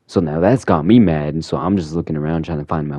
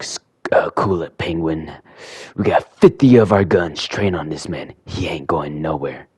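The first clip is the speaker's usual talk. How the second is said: tough guy voice; 2 semitones lower than usual. Retold or imitated. Two-Face voiced.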